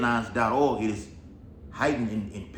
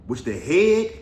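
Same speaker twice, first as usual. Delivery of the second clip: loud preaching emphasis; 5 semitones higher, 6 dB louder, slower than usual. Acted. Rereading the verse loudly.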